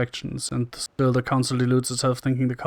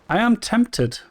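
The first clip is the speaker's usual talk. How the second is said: normal talking voice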